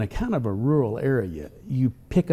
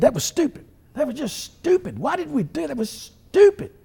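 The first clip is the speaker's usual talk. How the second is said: much higher, louder mock-angry voice of his friend; a hammed-up, high-pitched imitation of a fuming friend; imitating angry friend; 3 dB louder than usual. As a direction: squeak it out like your hunting buddy fuming. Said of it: "He was really mad".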